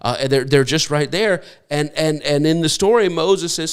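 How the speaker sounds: normal talking voice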